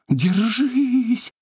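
high, loud cry; shouted. Acted. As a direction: yell to hold on. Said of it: "кричала улитка". (громкий высокий крик)

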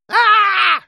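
sudden piercing scream turning to a squeal; anguished scream. Acted. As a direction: scream out in agony. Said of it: "истошный вопль, переходящий в визг".

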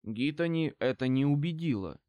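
ordinary delivery, nothing marked

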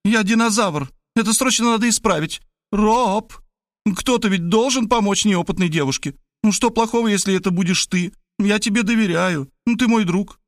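put-on high girlish voice, indignant then pleading; cross young woman. A narrator's reading. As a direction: protest and press him, pitched well up. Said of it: "Она рассердилась". (высокий девичий голос, возмущённо уговаривает)

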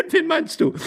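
very high question through laughter; giggling lady. Acted. Wife asking her husband, high and giggly. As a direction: ask it while tittering.

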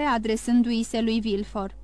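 ordinary delivery, nothing marked

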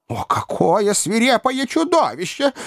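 high, frightened exclamation; horrified governess. A narrator's reading. A governess shrieks at the intruder, horrified. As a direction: gasp it out like a scared teacher. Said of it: "воскликнула другая воспитательница".

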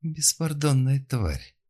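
slow, loud and high with outrage; indignant. A narrator's reading. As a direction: spit it out, deeply offended. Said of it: "Гуру возмутилась".

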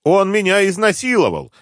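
very high, loud triumphant yell; радостно орёт. A narrator's reading. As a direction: yell the accusation high, relishing it. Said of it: "радостно орала". (ликующий высокий крик)

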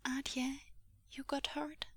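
high, soft, small voice; hushed, high-pitched concern; worried child. A narrator's reading. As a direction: ask timidly if Dad is hurt. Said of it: "Ai Yuan's worried eyes".

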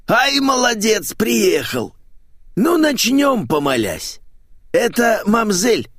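high, drawn-out parrot-man voice; flamboyant showman, exuberant. Acted. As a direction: gush in a high, theatrical welcome.

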